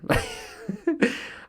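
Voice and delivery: real burst of high laughter; laughing at himself. Real, not acted. Laugh alone.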